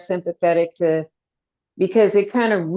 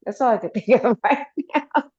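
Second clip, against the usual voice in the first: breaking into a laugh; laughing through the words; 7 semitones higher than usual. Real, not acted. Laughs mid-sentence.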